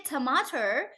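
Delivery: tomato as "tuh-MAH-cher"; American mispronunciation. Shown as a demonstration. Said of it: "they have never heard the sound".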